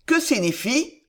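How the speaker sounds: loud, gruff demand; growling. A narrator's reading. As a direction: bark the question at them. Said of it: "gronda Montluc".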